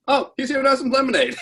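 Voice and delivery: high-pitched imitation of a friend covering; Susan. Friend explaining George's visit to the cop, high-pitched imitation. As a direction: cover for him in a high mock voice.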